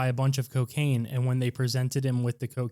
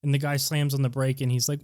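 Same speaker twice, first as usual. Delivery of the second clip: ordinary delivery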